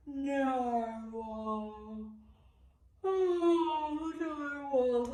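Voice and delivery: long yawning cry; narwhal yawn. Shown as a demonstration. Teacher yawning narwhal with toddlers. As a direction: yawn big through narwhal. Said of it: "Let's yawn narwhal".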